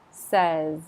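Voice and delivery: Says with stress on the start and a solid z; solid final Z. Shown as a demonstration. Teacher drilling says for learners, solid end. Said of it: "solid on the end".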